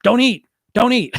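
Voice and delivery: high, loud nagging repeat; frantic self-scolding. Retold or imitated. Dieter shouting at themselves not to eat, high and loud. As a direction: snap the order at yourself in a panic. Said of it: "And you're like".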